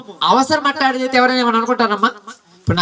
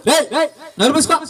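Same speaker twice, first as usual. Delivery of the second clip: quick, higher mock telling-off; retold scolding; 4 semitones higher, faster than usual. Bystanders telling the blind man to shut up, mock scolding. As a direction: shush him fast, pitch jumping up.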